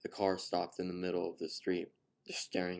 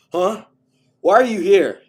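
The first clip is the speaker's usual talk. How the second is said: much higher, much louder roar; furious shout; 13 semitones higher, 23 dB louder than usual. A narrator's reading. Father catching a young man at his house, furious shout. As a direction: bellow at the trespasser. Father pins him to car.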